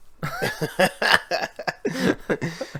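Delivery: high, sustained laughter; high-pitched run of giggly laughter; laughing. Real, not acted. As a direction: keep laughing, high and easy. Laughing at the Bonza quote.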